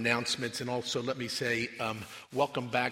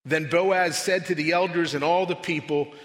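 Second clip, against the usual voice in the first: loud, raised, declamatory Bible reading; proclaimed scripture reading; 4 semitones higher, 8 dB louder than usual. Real, not acted. Preacher reads the book of Ruth to the congregation, proclaiming. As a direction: proclaim it to the whole room, phrase by phrase with weighty pauses.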